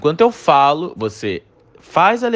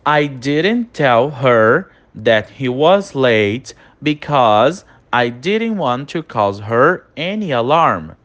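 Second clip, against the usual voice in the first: slow dictation; 2 semitones lower, much slower than usual. Shown as a demonstration. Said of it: "Olha a nossa segunda frase de exemplo".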